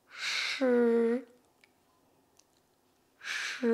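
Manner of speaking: "Ch" as a long French hushing sound with no i; drawn-out sh. Shown as a demonstration. Teacher demonstrates S H I, "Ch" held long. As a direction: model a long, isolated "Ch". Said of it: "S H I".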